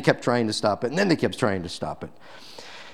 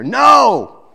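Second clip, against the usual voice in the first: a sudden, much higher and louder "No!"; emphatic shout; 11 semitones higher, 10 dB louder than usual. Real, not acted. Preacher to congregation, rejecting the dismissal with a loud shout. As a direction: shout it down hard, like slamming a door.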